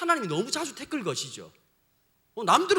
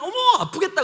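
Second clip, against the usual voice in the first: higher, louder fake pity; mock sympathy; 8 semitones higher, 7 dB louder than usual. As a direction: pretend to feel sorry, sing-song. Imagined sympathetic reaction.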